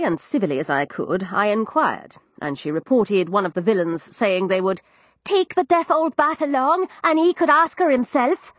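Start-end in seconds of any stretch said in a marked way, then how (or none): 5.2-8.5 put-on higher, young cockney maid; frightened servant girl, higher cockney; Florrie's voice. Narrator voices East End maid.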